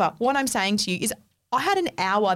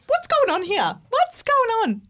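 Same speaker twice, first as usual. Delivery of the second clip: alarmed question; 12 semitones higher than usual. Retold or imitated. Confronting the guy about her stripped bed, alarmed. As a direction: demand answers, getting more alarmed. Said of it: "so I said".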